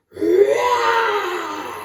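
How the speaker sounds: high, loud roaring shout; big storybook lion roar. A narrator's reading. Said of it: "Then he roared very loud".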